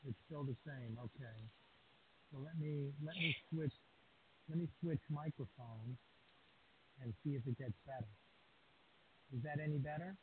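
distant, faint voice off the microphone; speaking far from the microphone; far from mic. Real, not acted.

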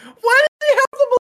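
high, loud squeal of disbelief; a sudden high cry of disbelief; shocked disbelief. Real, not acted. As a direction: shriek at the ridiculous hat. Friends react to a costume.

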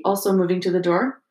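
ordinary delivery, nothing marked

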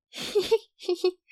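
high little giggle, held back; classmates giggling. A narrator's reading. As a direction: giggle like classmates hiding a laugh. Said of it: "同学们小声的笑起来".

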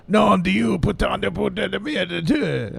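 high, rolling French swearing; French accent. Acted. Cohost quoting the Merovingian's swearing, high mock French accent. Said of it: "that's my French accent".

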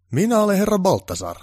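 self-important boss voice; pompous director. Acted. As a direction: announce yourself grandly. Pompous director introduces himself.

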